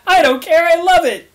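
high excited squeal; gleeful scream. Acted. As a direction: shriek it with giddy joy. Character cheering wildly.

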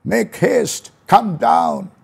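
voicing Jesus calling to Zacchaeus. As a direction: call him down from the tree. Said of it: "He told Zacchaeus".

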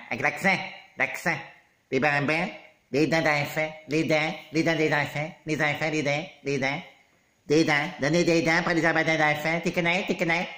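low, soft Québécois vowels; playful put-on Québécois accent. Shown as a demonstration. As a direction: show off a Québécois accent on nasal words.

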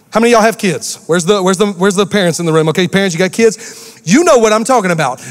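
ordinary delivery

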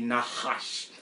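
Nahash as nah-HAHSH, very low; low, deliberate Nahash, closing on sh; Hebrew pronunciation. Shown as a demonstration. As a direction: pronounce Nahash with open ah vowels and a breathed middle h. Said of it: "That's the correct pronunciation of the word".

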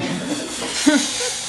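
airy unvoiced giggle; a quick breathless laugh; breathy laugh. Real, not acted. Laughing at his own prank.

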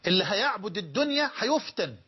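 ordinary talk

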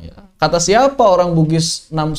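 usual speaking voice

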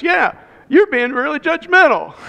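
chirpy agreement; imitating Joanne. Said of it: "Joanne says".

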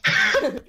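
sudden high-pitched giggle; high laugh. Real, not acted. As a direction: burst out laughing, squeaky. Laugh after question.

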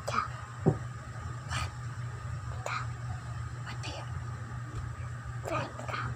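secretive whisper. Real, not acted. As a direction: whisper it so it's barely audible. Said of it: "What are you whispering?".

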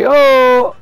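sharp high loud "oh!"; richiamo gridato. Real, not acted. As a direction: call out loudly to get their attention. Calling the unresponsive friend. (richiamo urlato)

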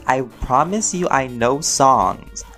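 ordinary talk, nothing marked

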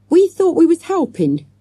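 put-on high, loud child defending herself; stoutly. A narrator's reading. Avril defending the children's tackle, stout high protest. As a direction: stand your ground, high and loud. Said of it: "said Avril stoutly".

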